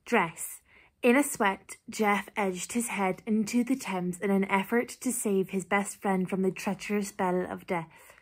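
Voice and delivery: ordinary delivery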